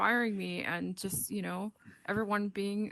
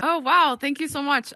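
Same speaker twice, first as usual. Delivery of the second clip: pleasantly surprised; 5 semitones higher, 8 dB louder than usual. Real, not acted. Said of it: "I'm really surprised".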